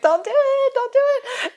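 high squeaky pleading. Retold or imitated. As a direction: beg them in a high voice. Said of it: "I'm like".

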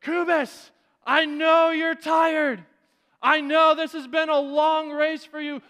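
high, loud urgent shout; coach shouting. Retold or imitated. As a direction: yell him on to the finish. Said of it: "Coach Badway goes".

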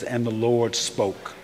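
slow, weighty scripture reading. Shown as a demonstration. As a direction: let each word of the verse land slowly. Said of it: "Isaiah seven ten says".

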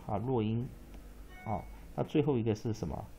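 ordinary delivery

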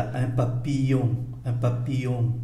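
"papillon" said quickly as one word; quick reading. Shown as a demonstration. Teacher shows learners the natural pace, quick "papillon". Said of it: "Tôi đọc nhanh là".

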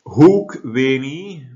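loud, high, very slow recitation; reading Latin aloud. Real, not acted. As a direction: announce the Latin slowly and forcefully. Teacher reads Latin text aloud.

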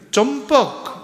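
high loud call of a name, dropping at the end. Acted. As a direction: call your old friend sharply by name. Friend calls name into well.

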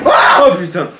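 startled loud cry; scared horror game streamer. Real, not acted. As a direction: yelp in fright. Monster catches him. (cri de surprise effrayé)

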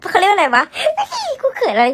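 flustered giggle through the words; embarrassed, giggly self-interruption; bashful laughing. Real, not acted. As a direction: laugh at your own shyness as you talk. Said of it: "กูเขินอะไรกู".

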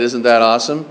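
low, flat "Isn't that awesome?" with no lift; unexcited. Retold or imitated. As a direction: mimic a bored congregation. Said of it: "didn't sound too excited".

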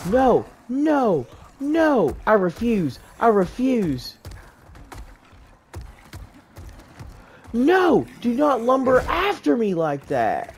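panicked. Real, not acted. Monster chasing him.

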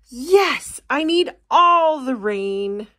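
a thirsty plant cheering; high excited sprout; eager yes. Acted.

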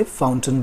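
"फाउंटेन" as FOWN-tun; corrected pronunciation. Shown as a demonstration. Teacher telling students to say "फाउंटेन" as FOWN-tun. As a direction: weaken the end of "फाउंटेन" to a short "tun". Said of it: "इसे फाउंटेन बोलिए".

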